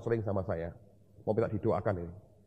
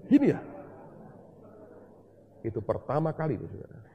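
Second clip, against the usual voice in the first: ordinary delivery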